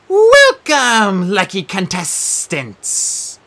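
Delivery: high, loud, slow showbiz welcome; game show host. Acted. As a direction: ham up the host's greeting. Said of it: "she announced".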